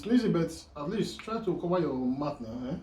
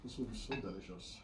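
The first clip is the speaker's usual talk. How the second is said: much softer, lower murmur; quiet; 5 semitones lower, 15 dB quieter than usual. Real, not acted. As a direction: murmur it to yourself, savoring. Repeats praise of food.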